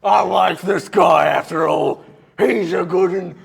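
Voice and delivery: loud, booming friendly giant; dim giant cyclops. Acted. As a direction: boom it out like a happy drunk giant. GM voices pleased giant.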